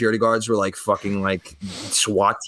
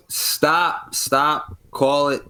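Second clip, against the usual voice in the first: lightly voiced doctor, pitched up; doctor calling it; 5 semitones higher, much slower than usual. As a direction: halt the team with spaced-out, higher words. Doctor halting the resuscitation.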